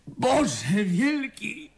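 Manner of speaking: discovering a dead friend, horrified; horrified exclamation. Acted. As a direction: draw out the shock. Discovering Betty's body.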